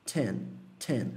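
"ten" low and soft, light TS onset; ten com S leve. Shown as a demonstration. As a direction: add a very light S after the T of "ten". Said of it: "um S muito levinho". ("ten" com T-S bem levinho)

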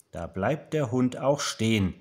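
ordinary delivery

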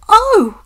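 high, loud cry of "oh"; high-pitched loud yelp; sharp cry. A narrator's reading. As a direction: cry out suddenly. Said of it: "cried the van".

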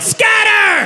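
scatter, shouted high; yelled command. Retold or imitated. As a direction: scream it to send everyone running. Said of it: "and yelled".

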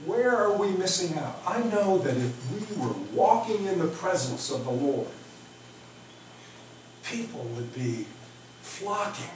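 usual speaking voice

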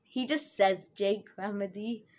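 ordinary talk

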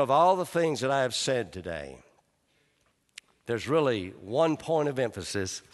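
normal talking voice

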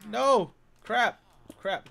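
loud high yelp of frustration; loud angry yelp; frustrated shout. Real, not acted. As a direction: shout it as it all goes wrong. Villager lost.

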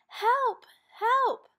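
put-on high, loud child's cry for help. A narrator's reading. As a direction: shout for help as a small child. Child slides off soap.

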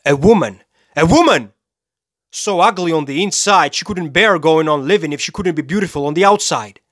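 loud, high burst of scorn; outraged contempt. Acted. Killer condemns his vain victim, loudly scornful. Rants about a woman victim.

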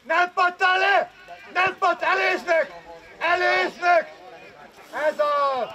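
shouted coaching. Real, not acted. Coach directs and praises a player, shouting. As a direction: project it over the pitch.

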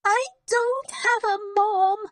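very high, loud wailing cry. A narrator's reading. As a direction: wail it like a tantrum. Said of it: "But Lottie just cried louder".

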